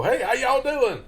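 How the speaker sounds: high, loud, drawn-out overdone hello; hammed-up greeting to a lone stranger; mock hearty greeting. Showing how not to greet one person in the street, exaggerated loud greeting. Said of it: "you don't go".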